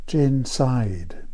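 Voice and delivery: plain reading voice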